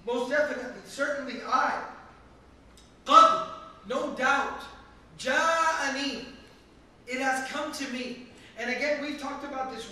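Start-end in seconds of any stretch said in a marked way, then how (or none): 0.1-6.2 "qad" drawn out and very loud; mounting emphasis. Shown as a demonstration. Teacher stacking degrees of emphasis, "qad" and "ja'ani" hit emphatically. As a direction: punch "most definitely", then "qad" and "ja'ani" louder. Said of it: "your third degree of emphasis".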